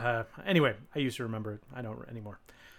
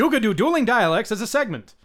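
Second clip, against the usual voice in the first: louder, higher, emphatic read; loud takeover read; 7 semitones higher, 8 dB louder than usual. Real, not acted. Taking over from co-host who can't read, loud read. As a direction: read it out big for a stumbling reader.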